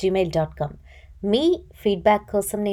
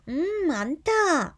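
higher, slow, drawn-out thrilled hum; giddy excitement; 8 semitones higher, much slower than usual. A narrator's reading. Anitha thrilled as the spirit game begins, drawn-out giddy hum. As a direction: savour it like she can barely contain herself. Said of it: "పట్టలేని హుషారుతోటి".

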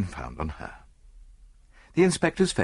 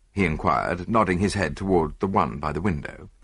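usual speaking voice

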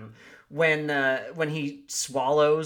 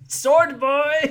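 much higher, louder bark of a summons; mock yell; 12 semitones higher, 9 dB louder than usual. Host imitating the hero calling his sword boy, loud mock yell. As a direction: yell for the servant like a pompous hero. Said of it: "he's like".

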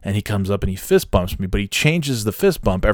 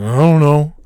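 loud, high put-on whine; exaggerated clueless answer; mock helpless shrug; 6 semitones higher, 7 dB louder than usual. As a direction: imitate the coworker shrugging it off.

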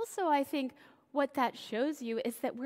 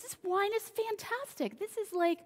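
much higher, delighted exclamation; amazed wine steward; 10 semitones higher than usual. Retold or imitated. Wine steward tasting the new wine, high and delighted. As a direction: gush over the wine in a high voice. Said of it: "he tastes this, he goes".

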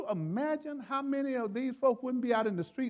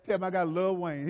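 usual speaking voice

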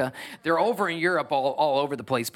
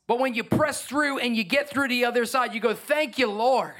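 higher-pitched, jubilant praise; triumphant sermon climax; fervent preaching; 8 semitones higher than usual. Acted. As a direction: lift it up to the Lord. Preaching climax, thanks to God.

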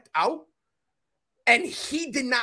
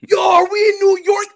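much higher, louder panicked shout; replicated high freak-out; 11 semitones higher, 10 dB louder than usual. Shown as a demonstration. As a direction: recreate the stoned panic as a yelling cry. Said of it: "I'm going to replicate it for y'all".

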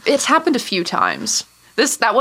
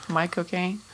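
Michael Caine accent; 2 semitones lower, 11 dB quieter than usual. Shown as a demonstration. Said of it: "Say it".

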